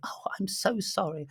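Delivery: high, breathy whispered fake sympathy; mock pitying whisper. Mocking a carer pitying a patient, high whispered sympathy. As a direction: whisper it like a mock-tender nurse. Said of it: "I've never had anyone go".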